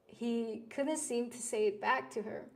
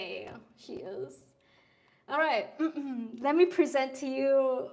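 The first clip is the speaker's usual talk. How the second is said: usual speaking voice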